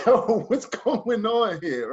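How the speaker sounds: slow, drawn-out confusion; a drawn-out bewildered question to the gym. Retold or imitated. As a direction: stretch it out, totally lost. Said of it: "I'm like".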